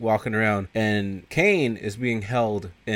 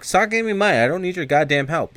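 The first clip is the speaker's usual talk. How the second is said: higher, angry refusal; indignant outburst; 8 semitones higher than usual. Retold or imitated. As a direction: snap back at mom. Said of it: "He's like".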